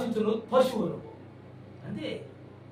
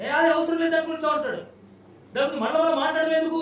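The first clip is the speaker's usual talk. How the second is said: dismissive retort; 7 semitones higher, 6 dB louder, faster than usual. Retold or imitated.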